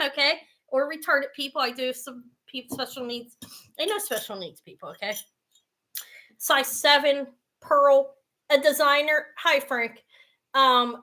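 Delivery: ordinary delivery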